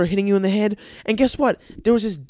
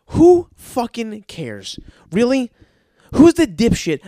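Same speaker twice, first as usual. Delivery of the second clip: higher, louder, slow outraged question; exasperated rant; 6 semitones higher, 6 dB louder, slower than usual. Real, not acted. Comic ranting about a beach-ball crowd: louder, higher exasperation.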